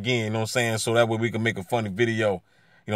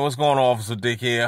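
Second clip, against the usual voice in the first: usual speaking voice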